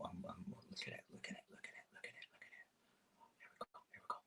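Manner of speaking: hushed, giddy chant of look at it; excited whisper. Real, not acted. Watching syrup pour onto his porridge, in an excited hushed whisper. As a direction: whisper fast, barely able to contain delight.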